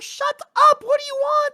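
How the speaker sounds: mock high voice. Friend opening the podcast, squeaky mock voice. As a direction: play a shrill brat snapping at them.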